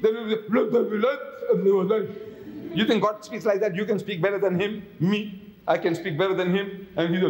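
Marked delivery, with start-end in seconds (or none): 0.5-2.1 mushy consonants, as if speaking with no teeth; toothless mumble. Retold or imitated. Preacher mocks a toothless God saying the line, mumbled. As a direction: gum the words like a toothless old man. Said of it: "a mouth and no teeth".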